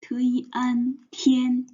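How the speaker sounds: "te yi an" in separate high syllables, then "tian"; syllable-by-syllable pronunciation. Shown as a demonstration. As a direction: sound out "te yi an" and blend it into "tian". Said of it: "In Chinese, we".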